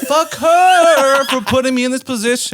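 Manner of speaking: high-pitched, drawn-out furious wail; freaking out. Retold or imitated. Cursing the girl who left him stranded, high freak-out. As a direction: re-enact losing it, voice way up and stretched. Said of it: "And then I started freaking".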